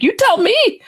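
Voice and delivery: very high-pitched retort; re-enacted squeal of disbelief, pitch shooting up; exasperated disbelief. Answering the friend who asked what that was, exasperated disbelief.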